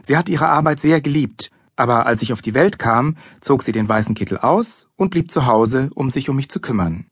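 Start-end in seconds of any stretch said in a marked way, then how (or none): none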